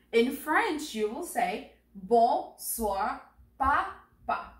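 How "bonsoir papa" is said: "Bonsoir, Papa" slowly, each syllable apart. Shown as a demonstration. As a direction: model "Bonsoir, Papa" beat by beat. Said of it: "Repeat after me".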